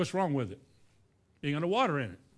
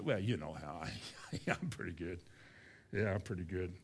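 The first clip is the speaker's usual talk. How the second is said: mock humble bragging; 8 semitones lower, 7 dB quieter than usual. Preacher brags with fake humility.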